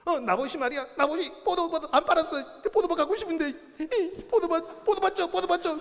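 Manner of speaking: high-pitched tantrum voice, wheedling; whining childish king. Acted. King complaining to his wife about the vineyard, childish whining. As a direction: whine like a child begging for a toy. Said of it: "이러고 있는 거예요".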